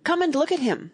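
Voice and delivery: high, loud eager invitation; eager call; excited cry. A narrator's reading. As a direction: call them over excitedly. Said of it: "the brothers cried".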